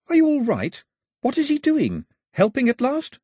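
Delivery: cheerfully unaware, calling up from the canoe; cheery questions. A narrator's reading. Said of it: "with the cheeriness of someone who doesn't know".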